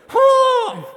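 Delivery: high, loud imitated whoop; martial-arts cry. Big guy squaring up to fight, imitated high yell. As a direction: belt out a mock fighting cry. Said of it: "and he goes like this".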